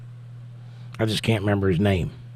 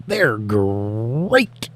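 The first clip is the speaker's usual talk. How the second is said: lightly voiced cartoon tiger, drawn out; a hint of the cereal box tiger; Tony the Tiger imitation. Streamer imitating the cereal tiger, lightly voiced. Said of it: "the tiger on the carton would say".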